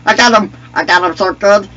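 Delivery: high, loud celebration; triumphant excitement. Real, not acted. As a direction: crow happily over the kill. Celebrates a kill.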